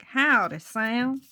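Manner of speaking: loud mock-American greeting; badly faked American howdy; poor American accent. The others greet Sam the scarecrow, poor American accent. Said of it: "my really poor American accent".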